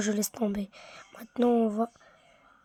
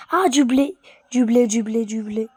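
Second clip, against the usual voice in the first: excited; 5 dB louder than usual. Real, not acted. Excited about finding wheat.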